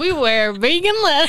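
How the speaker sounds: high, hammed-up southern proclamation breaking into laughter; over-the-top southern accent, cracking up. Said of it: "the accent".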